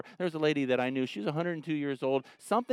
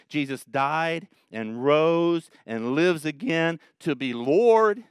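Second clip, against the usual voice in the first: slow, emphatic quote; quoting Paul slowly; slow scripture quote; 3 dB louder, slower than usual. Retold or imitated. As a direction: read the verse weightily. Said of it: "Paul did".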